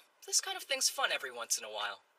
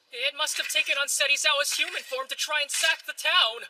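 loud, high theatrical delivery; forceful melodramatic explanation; stage declamation; 11 semitones higher, 7 dB louder than usual. Acted. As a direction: project the recovered line to the audience. Recovered line declaimed on stage.

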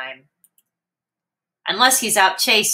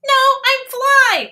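very high tiny fly; 5 dB louder than usual. Acted. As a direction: squeak it like a little fly. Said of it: "says Fly".

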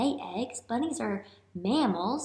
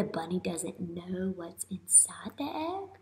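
whisper; 3 semitones lower, 8 dB quieter than usual. Real, not acted. Teacher hints at prediction.

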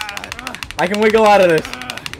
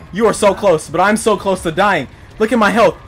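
ordinary delivery

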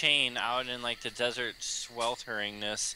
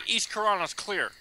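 agent report voice; 10 semitones higher than usual. Pretend. Acted FBI agent report.

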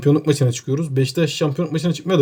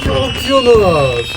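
triumphant; 7 semitones higher, 4 dB louder, much slower than usual. Real, not acted. Countdown to winning the title.

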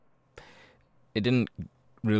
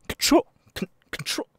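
loud "Control!" then breathy repeat; blurted tic; 6 dB louder than usual. Acted. [name] tics right after explaining his Tourette's, loud blurt. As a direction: shout it out, then echo it under breath. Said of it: "I can't really control it".

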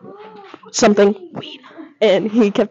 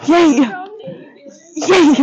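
excited cheer; 10 semitones higher than usual. Real, not acted. Celebrating the yes.

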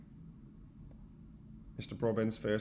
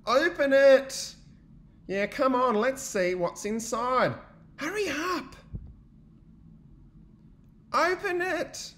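eager urging; 13 semitones higher, 7 dB louder, slower than usual. Acted. Friends urging bear.

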